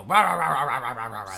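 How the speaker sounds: a mock upset chant, half laughed; mock angry rah. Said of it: "And they go".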